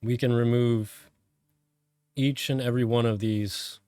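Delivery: calm, measured meditation voice with long pauses; guided meditation. Real, not acted. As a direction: guide them inward, phrase by phrase, letting each one settle.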